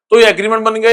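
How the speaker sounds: usual speaking voice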